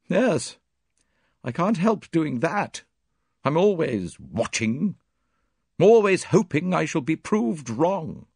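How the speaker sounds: high, slow, anguished confession; pained, drawn-out admission; troubled Miss Howard. A narrator's reading. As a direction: admit it slowly in a strained high pitch.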